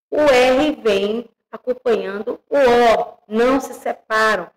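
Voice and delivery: ordinary talk, nothing marked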